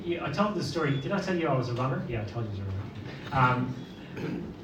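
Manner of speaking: ordinary talk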